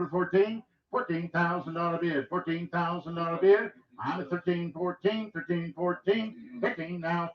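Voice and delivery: rapid rhythmic auction chant; auctioneer. Acted. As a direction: roll the numbers like a bid caller. Auction bid calling.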